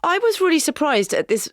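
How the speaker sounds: incredulous. Real, not acted. Incredulous about volunteer leaving.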